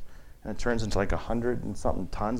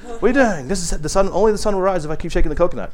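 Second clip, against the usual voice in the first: higher and louder, half-mocking disbelief; teacher mocking a student, higher and louder, exasperated; incredulous teacher; 7 semitones higher, 7 dB louder than usual. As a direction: scold the coconut shaker, fast at the end. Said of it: "you know, like".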